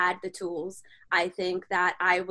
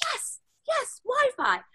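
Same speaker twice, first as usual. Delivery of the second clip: giddy relief; 13 semitones higher, slower than usual. Retold or imitated. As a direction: act out being thrilled in a tiny high voice. Retold relief at finding Wi-Fi.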